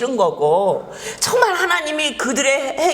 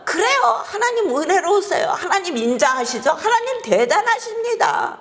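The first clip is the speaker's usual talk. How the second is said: higher, mocking singsong compliments; sarcastic praise; 7 semitones higher than usual. Jonah bitterly mocking God's kindness, sarcastic. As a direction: heap on fake praise through a sneer.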